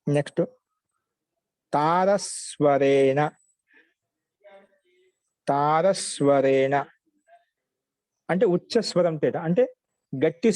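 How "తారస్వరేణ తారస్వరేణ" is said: very slow, drawn-out syllables; slow dictation. Shown as a demonstration. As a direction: stretch each syllable for students writing it down. Dictating a new word to students. (నెమ్మదిగా సాగదీస్తూ పదం రాయించడం)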